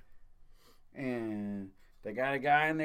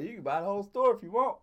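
1 cashier; 6 semitones higher, 4 dB louder, faster than usual. Retold or imitated. Cashier approves his card.

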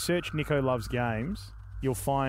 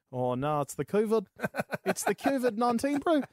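mock Kiwi accent; 7 semitones higher than usual. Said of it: "people are walking around out there going".

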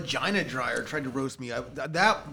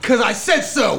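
louder, higher punchline delivery; emphatic punchline; 6 semitones higher, 10 dB louder than usual. Acted. Comic tells his roast joke to friends, emphatic punchline. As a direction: push the joke's last words out loud.

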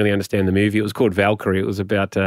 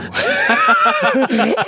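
cackling laughter, much higher; high burst of laughter; 4 dB louder than usual. Retold or imitated. Pranksters crack up.

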